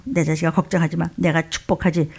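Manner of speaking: low, soft reassurance; comforting father. Acted. God the Father answers a crying child, comforting. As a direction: soothe a crying child quietly. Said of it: "자식이 우는데 가만히 있을 아버지가".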